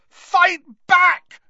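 furious high scream, loud on both words; Harry. A narrator's reading. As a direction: scream a challenge at your enemy. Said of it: "Harry screamed at him".

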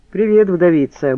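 lively, teasing hello; cheery. A narrator's reading. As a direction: greet her briskly and brightly. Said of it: "бодро сказал он".

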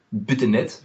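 ordinary delivery

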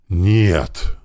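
understated gasp of dismay; narrated gasp. A narrator's reading. Corporal facing a gunman, understated gasp. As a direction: gasp it out, held back. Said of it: "ахнул ефрейтор".

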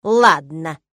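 grudging, clipped agreement; old teacher grudgingly agrees to teach her; reluctant ladno. A narrator's reading. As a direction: give in unwillingly. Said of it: "нехотя ответила".

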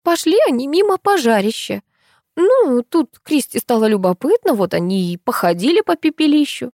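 high-pitched animated woman's voice; lively, high-register explaining; Tamara, explaining friend. Acted. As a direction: recount the mishap in a high character voice.

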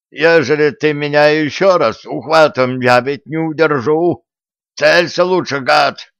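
hoarse voice. Acted. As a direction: rasp it, drunk and annoyed. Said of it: "сиплый голос жучка".

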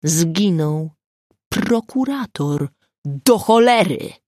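a touch of a furious yell; angry outburst. Acted. Angry prosecutor swears.

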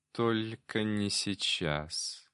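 soft, very slow otherworldly voice; calm, sure and eerie; a cat demon answering softly and slowly. A narrator's reading.

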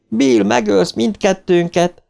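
high, slowed cry half in fear, half in laughter; laughing, frightened cry. A narrator's reading. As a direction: scream at him, but enjoying it. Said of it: "kiáltotta Ódra".

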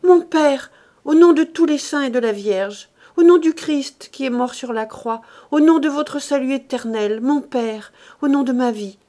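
loud, insistent imploring; desperate pleading. A narrator's reading. Daughter on her knees begging father to stop, pleading.

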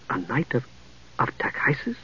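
hushed, faltering disbelief; almost whisper it, breaking off and rising in shock; aghast. A narrator's reading. Young mage learning she serves Takhisis, hushed and aghast. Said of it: "Palin gaped".

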